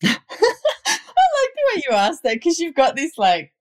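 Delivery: laughing hard through the words; can't stop laughing while talking; giggling, high and breathless. Real, not acted. Host laughing while asking.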